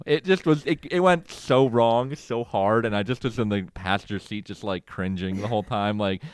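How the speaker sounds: ordinary delivery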